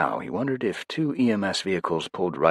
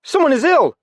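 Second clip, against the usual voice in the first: very high, loud alarmed call; very high, loud call from the crowd; 11 dB louder than usual. A narrator's reading. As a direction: raise the alarm across the crowd. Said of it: "a voice called from the crowd".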